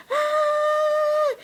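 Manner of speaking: high, sustained mock scream; long "ah" held high, imitating a runner; imitated scream. Host mimics her mom winning the raffle, imitated scream. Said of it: "all you can hear her screaming".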